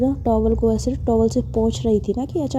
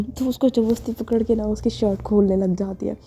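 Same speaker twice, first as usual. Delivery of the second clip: ordinary delivery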